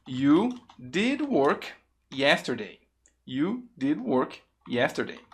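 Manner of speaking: "You did work yesterday" in separated chunks; slow careful model. Shown as a demonstration. Teacher presents the emphatic version to learners, slowly and deliberately.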